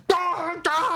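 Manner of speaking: high, loud mock panicked cry. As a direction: ham up a panicked shriek. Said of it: "they're like".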